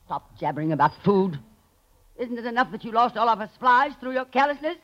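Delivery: high-pitched angry tirade; furious scolding. Acted. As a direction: tear into him for losing the food, pitch climbing. Connie berates Ritz about supplies.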